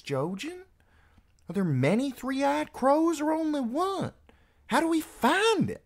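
put-on high-pitched girl protesting; insistent young girl's argument, pitched well up; exasperated sister. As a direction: challenge each claim, voice climbing very high. Voices Meera challenging Jojen.

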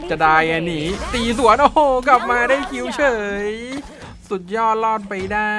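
high, loud caster hype; excited hype. Real, not acted. Caster hyping the player's escape, high and loud. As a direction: sell the comeback escape.

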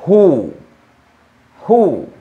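who said as hoo, with an h and a long oo; teacher modelling who, rounded hoo sound; correct English who. Shown as a demonstration. Teacher shows Spanish students how to say who. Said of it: "cuya pronunciación es".

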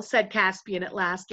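usual speaking voice

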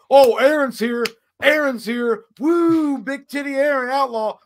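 high, loud whooping imitation; mock rowdy cheer. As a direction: hoot like a rowdy groomsman greeting someone. Groomsman hooting at arrival, retold.

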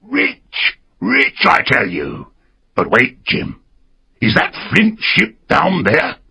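a lightly voiced wild-eyed sailor; excited castaway. A narrator's reading.

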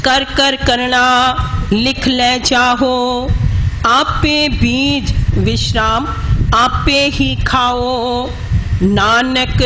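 slow, measured recitation with drawn-out endings; scripture recitation. Shown as a demonstration. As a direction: recite phrase by phrase, pausing at each marked rest. Teacher recites scripture phrase by phrase. (ਠਹਿਰ ਠਹਿਰ ਕੇ ਗੁਰਬਾਣੀ ਪਾਠ)

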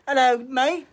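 high, loud mock-British introduction; attempted British accent. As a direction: announce the cat in over-the-top British. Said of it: "that accent you're doing".

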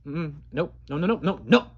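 usual speaking voice

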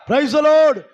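rally the church with a shout; ringing call of praise from the pulpit. Real, not acted. Preacher rouses the congregation.

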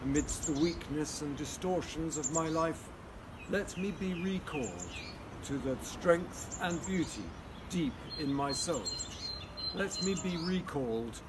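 solemn spoken prayer. Acted. Leads an opening prayer.

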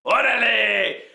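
loud, drawn-out sign-off cry; shouted catchphrase. Acted. Paco closing his bit, shouted catchphrase. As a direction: belt out the catchphrase, stretching the end.

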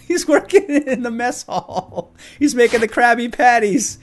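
high, loud delivery through laughter; gleeful laughing exclamation. Real, not acted. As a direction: crack up while announcing it. Laughing at character working in kitchen.

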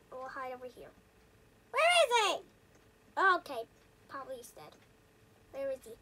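normal talking voice

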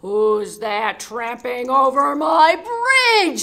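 huge slow troll roar. Acted.

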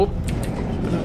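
slow, breathy whisper. Real, not acted. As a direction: whisper it slowly to the door. Pleading with the game door.

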